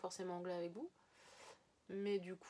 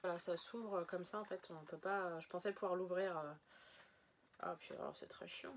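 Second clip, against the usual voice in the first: ordinary talk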